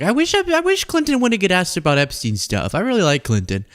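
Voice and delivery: a hint of Trump musing fondly; mock Trump wishing aloud; Trump impression. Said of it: "Trump's like".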